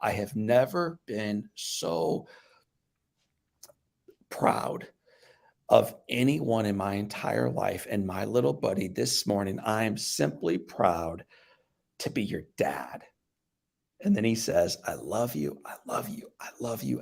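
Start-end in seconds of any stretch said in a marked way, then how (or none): 0.0-13.0 slow, low, hushed father's voice; slow right down, sinking softer and lower; tender father. Retold or imitated. Retells father's words to injured son. 14.8-17.0 soft, near-whispered declaration of love; hushed, sincere repetition, letting the voice almost vanish; tender father. Retold or imitated. Retells father's repeated declaration of love.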